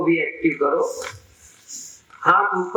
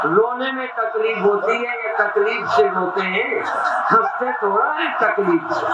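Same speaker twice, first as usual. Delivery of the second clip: exuberant laughing exhortation; 5 semitones higher than usual. Real, not acted. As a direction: cheer them into real laughter. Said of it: "हंसो, सचमुच हंसो".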